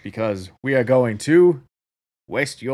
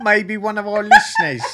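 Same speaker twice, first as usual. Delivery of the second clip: laughing, higher-pitched parody of the bad impression; mocking echo; 9 semitones higher, 4 dB louder than usual. Co-host parroting her impression of him, mocking. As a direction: throw the silly voice back through laughter.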